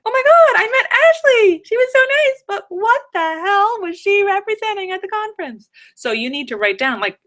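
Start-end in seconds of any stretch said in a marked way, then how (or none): 0.1-5.7 high, loud gushing impression of yourself; mock ditzy gush. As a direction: squeal it like a flustered attendee. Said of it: "you're gonna be like".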